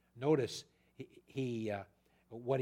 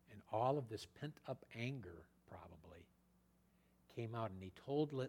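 whisper; 4 semitones lower, 11 dB quieter than usual. Real, not acted. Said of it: "unvoiced whisper".

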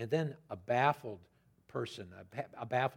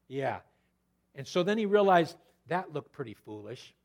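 usual speaking voice

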